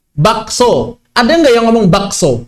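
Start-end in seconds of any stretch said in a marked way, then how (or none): 0.1-1.0 "bakso" with the k sounded; spelling pronunciation. Shown as a demonstration. Teacher reading the word as written, k sounded. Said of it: "Ada kaknya". 1.9-2.4 "bakso" with the k pronounced; spelling pronunciation. Shown as a demonstration. Teacher asking if anyone says it as written, k sounded. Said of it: "kaknya nggak dibaca".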